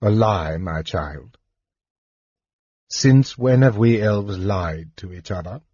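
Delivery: a hint of a sorrowful father; gently rebuking king. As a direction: chide her tenderly.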